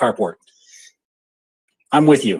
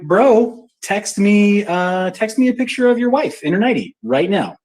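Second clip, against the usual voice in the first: silly teasing dare; 8 semitones higher, slower than usual. Retold or imitated. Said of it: "I'm being silly".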